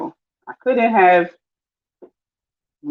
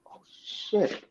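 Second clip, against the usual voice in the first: whispered oh shit; 2 semitones higher, 13 dB quieter than usual. Real, not acted. Breadcrumbs spilled.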